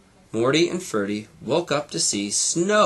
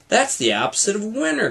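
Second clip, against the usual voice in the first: cried; 8 semitones higher, faster than usual. A narrator's reading. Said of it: "cried Morty".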